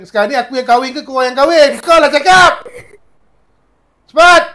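loud, high shouted demand; angry demand. Acted. Healer shouting at the spirit to speak up, furious.

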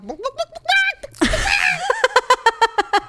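long, very high squawk; squawking noise imitation. Shown as a demonstration. Host mocking her own emotional voice, long high squawk. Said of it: "I feel like I sound like a".

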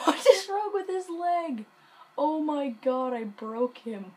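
high, loud cry through laughter; laughing exclamation. Real, not acted. Gamer cracking up at a character's broken leg, laughing exclamation. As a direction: burst out laughing, voice shooting up, then easing.

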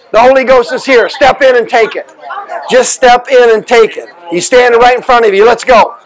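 fervent preaching. Acted. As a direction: preach it up with rising fire. Breaking a curse, rising fervour.

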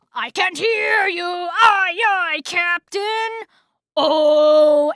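loud, high, drawn-out bellow; blaring shout, stretching the last words; mock megaphone blare. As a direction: drown everyone out with a sing-song holler. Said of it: "put this megaphone, uh, up to her mouth and go".

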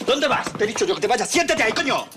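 loud, high furious commands; raging father barking orders; angry shouting. Acted. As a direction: yell him back into the chair. Father orders son to sit.

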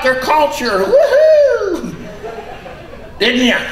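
high, squeaky puppet voice; squeaky ventriloquist dummy. Acted.

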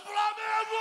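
high shouted team name; fan's shout. Retold or imitated. Preacher imitating a fan in the pews, high shout. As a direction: yell it like a proud fan. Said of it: "o cara falou".

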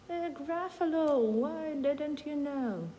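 high, slow, knowing mouse; a little mouse teasing the owl. A narrator's reading. Mouse answers owl in story. (мишка, повільно й високо)

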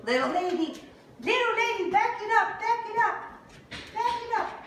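high, loud coaxing repeated; high coaxing command. Real, not acted. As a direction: coax her back, high and bright in pitch. Directing puppy to back up.

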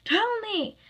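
high, loud furious demand; explosive high-pitched yell; shout. Acted. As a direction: shout it as an order. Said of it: "he shouted".

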